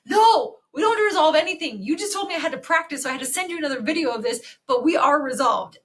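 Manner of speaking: high, loud acted-out refusal; retold outburst, high and loud, then easing; mock indignant protest. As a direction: burst out protesting, imitating an exasperated doctor.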